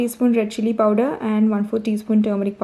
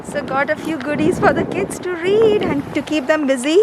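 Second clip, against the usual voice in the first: muffled behind a mask; talking through a face mask; 3 semitones higher than usual. Real, not acted. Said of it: "I don't know whether you can hear me with the mask on".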